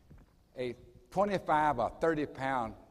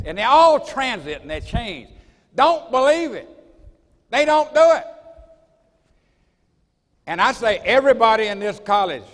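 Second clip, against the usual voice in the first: loud, driving preacher's exhortation; preaching at full force; 5 semitones higher, 12 dB louder than usual. Acted. Urging everyone in this college to carry a listing card, preaching loudly. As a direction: hammer it home to the whole room.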